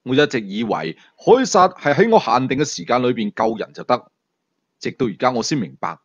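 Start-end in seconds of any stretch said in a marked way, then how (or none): none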